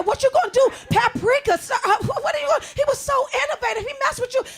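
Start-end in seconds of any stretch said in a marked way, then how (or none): 0.0-2.6 alarmed disbelief. Retold or imitated. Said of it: "I said".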